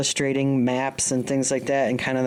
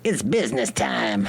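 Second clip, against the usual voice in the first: crazy little goblin man voice; 4 semitones lower than usual. Acted. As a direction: croak it like a goblin. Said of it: "you sound like a little goblin man".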